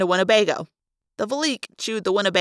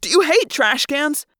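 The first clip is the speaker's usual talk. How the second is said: exasperated question; 5 semitones higher, 4 dB louder than usual. A narrator's reading. Passenger berates driver after crashes.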